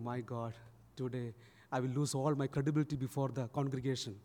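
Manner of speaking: inner thought. Retold or imitated. Priest fearing lost credibility before congregation, quiet inner thought. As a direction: think aloud under your voice. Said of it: "Achan was thinking".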